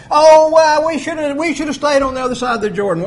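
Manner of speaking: loud, high, quick lament; regretful whine. Retold or imitated. Israelites lamenting after Ai, loud mock whine. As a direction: wail a regret. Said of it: "they had to run away".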